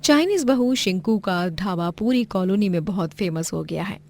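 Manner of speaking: plain reading voice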